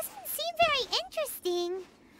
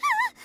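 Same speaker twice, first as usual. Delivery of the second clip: sharp high cry of fright; startled yelp; 12 semitones higher, 4 dB louder than usual. Acted. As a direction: jump at the sudden voice. Startled by voice in tunnel.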